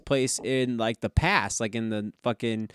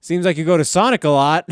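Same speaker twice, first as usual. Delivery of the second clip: higher, louder quote broken by laughter; laughing quote; 6 semitones higher, 10 dB louder than usual. Retold or imitated. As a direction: tease him through a laugh. Girl teasing the uncle.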